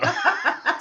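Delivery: short high laugh; high giggle. Real, not acted. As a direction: giggle brightly. Laughs at remark.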